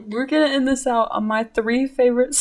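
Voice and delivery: ordinary talk, nothing marked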